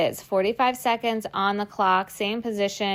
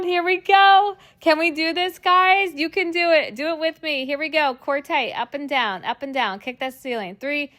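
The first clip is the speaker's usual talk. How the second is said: higher, louder push through the burn; rally the class to the finish; straining cheer; 6 semitones higher, 4 dB louder than usual. Real, not acted. Coach urging viewers through the last seconds, high and straining.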